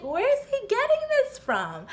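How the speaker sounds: very high, loud squeal of disbelief; incredulous. Retold or imitated. Woman baffled by gym partner offer, squealed incredulity. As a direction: pitch shoots up, utterly baffled. Retelling her baffled reaction.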